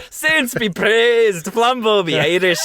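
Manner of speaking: slow, hammed-up Irish accent. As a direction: stretch it out in a big Irish brogue. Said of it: "in an Irish accent".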